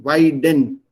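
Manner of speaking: "Weiden" with a clear d, loud; "Weiden" in two distinct syllables, vai-den; corrected pronunciation. Shown as a demonstration. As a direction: say "Weiden" firmly with a d in the middle. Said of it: "weiden, sorry, not weiden".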